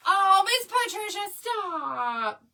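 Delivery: high, loud gushing cry of a name; flustered by a sweet compliment; overwhelmed and touched. Real, not acted. Touched by a viewer's kind comment, gushing.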